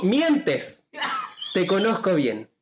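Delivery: put-on a theatrical shout at the lying boyfriend; mock accusing shout. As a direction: fling it out like a telenovela accusation. Melodramatic lyric recital to boyfriend.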